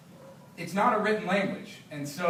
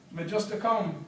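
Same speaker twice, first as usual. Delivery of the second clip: Jamaican patois; 3 semitones higher than usual. Acted. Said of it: "read some patois so you can hear".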